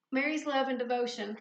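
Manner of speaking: ordinary talk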